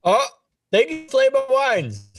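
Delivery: excited thanks. Real, not acted. Thanking a sponsor's donation.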